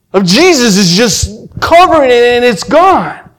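high, loud, overwhelmed testimony; impassioned, pitch soaring; fervent preaching. Real, not acted. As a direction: cry it out like a preacher at the climax. Preaches the climax of conversion.

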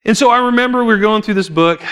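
usual speaking voice

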